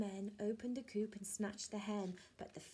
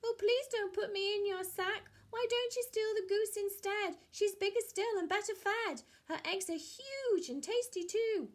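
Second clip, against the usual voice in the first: high, pleading bird voice, louder; pleading high character voice; 11 semitones higher, 6 dB louder than usual. Acted. Bird begging the thieves not to bag her, pleading high. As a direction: beg them to take the goose instead. Leads a story voice.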